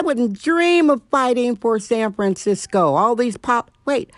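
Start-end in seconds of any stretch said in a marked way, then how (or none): none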